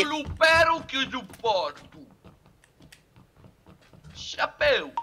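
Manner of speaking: high, very loud Sardinian gibberish; mock Sardinian accent. Friends riffing on Sardinia, mock Sardinian yell. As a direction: yell out hammed-up fake Sardinian.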